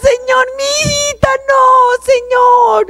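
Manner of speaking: very high, loud wailing father begging God; a sobbing father shouting for his little girl; high, crying shout of a broken father; father's anguished cry. Retold or imitated. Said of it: "Y mi papá grita".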